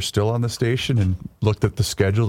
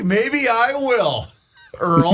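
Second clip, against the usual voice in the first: slow mock defiance; 13 semitones higher, much slower than usual. Mock defiant line to Earl.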